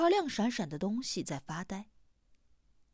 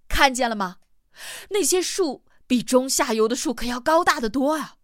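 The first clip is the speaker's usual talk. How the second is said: raised voice, higher and louder; 4 semitones higher, 6 dB louder than usual. A narrator's reading. Hamsen points Huaite to the trees, speaking loudly. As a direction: call out over a roaring waterfall. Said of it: "接着大声地说".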